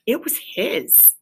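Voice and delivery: soft, slow whisper. A narrator's reading. Narrator whispers Mole's tender claim to home. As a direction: breathe it like a secret.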